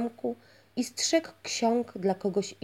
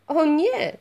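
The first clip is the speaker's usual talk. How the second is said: high, loud protest; firm denial; 9 semitones higher, 9 dB louder than usual. A narrator's reading. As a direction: deny it strongly, almost a cry. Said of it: "zaprzeczył czarodziej".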